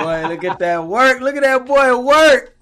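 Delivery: laughing, loud and high. Real, not acted. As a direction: cheer him on through laughter. Cheering friend's accent performance.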